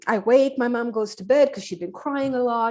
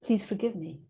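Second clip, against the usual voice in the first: soft plea; 2 semitones lower, 6 dB quieter than usual. Retold or imitated. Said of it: "she says".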